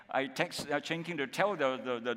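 ordinary talk

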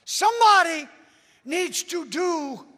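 high and loud, stretching needs then rushing; preaching it loud. Real, not acted. Preacher repeating his demand loudly to the congregation. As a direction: hammer the point home to the congregation. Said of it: "Do I need to say that again".

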